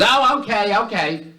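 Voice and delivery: high-pitched falsetto wife; cartoonish high wifely voice; wife's reply. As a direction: put on a high woman's voice, dropping off at the end. Yelling wife answers husband.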